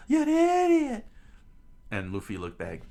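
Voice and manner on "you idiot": loud, very high falsetto screech; screamed. A narrator's reading. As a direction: screech the insult in a put-on girl's voice. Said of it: "Nami screamed out".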